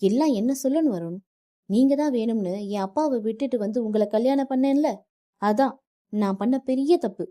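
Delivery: wife hurling insults at her husband's family; angry outburst. Acted. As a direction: lash out with words you regret. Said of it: "கோபத்தில் நித்யா வார்த்தைகளை விட".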